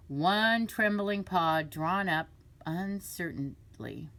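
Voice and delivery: usual speaking voice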